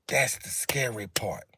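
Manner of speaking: hushed breathy whisper, slow. Real, not acted. Streamer to his live viewers about Democrats, whispered. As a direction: drop to a whisper to let it sink in. Streamer stressing grim point.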